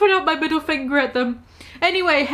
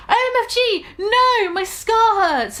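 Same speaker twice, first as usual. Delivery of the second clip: much higher, hammed-up pained cry, slowing on scar; mock scream; 10 semitones higher than usual. A narrator's reading. Harry suddenly in pain, hammed-up high scream. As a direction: wail like the scar is burning. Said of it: "he started to scream".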